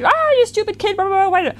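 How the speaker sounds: hammed-up parent snapping at the kid; mock angry parent. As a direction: parent in a bad mood scolding the kid's grade. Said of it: "because you're in a bad mood".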